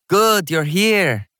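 put-on high, drawn-out greeting in a Jamaican accent; slight Jamaican accent. A narrator's reading. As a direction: greet them with a light Jamaican lilt. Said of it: "said in a slight Jamaican accent".

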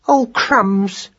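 high-pitched mild cry of alarm; dismayed cry. A narrator's reading. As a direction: cry out lightly, pitched well up. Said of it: "cried Madeline".

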